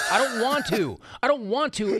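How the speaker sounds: retold protest to wife about tough love; emphatic protest. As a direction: push back hard on the wife.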